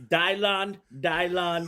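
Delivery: high, slow mock-Jamaican voice; hammed-up high Jamaican accent. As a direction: draw it out in a put-on Jamaican accent. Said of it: "You get the Jamaican accent out there".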